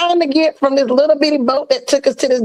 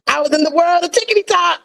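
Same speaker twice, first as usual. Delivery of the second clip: normal talking voice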